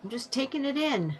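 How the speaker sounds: lilting mock contentment; breezy coping act. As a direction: play someone cheerfully putting up with it. Voicing someone in denial.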